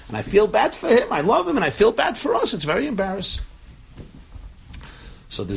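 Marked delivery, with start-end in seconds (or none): 0.1-3.4 high, loud wail of a mock-pleading father; retold father's lament. Father complains about his one boy in therapy, lamenting.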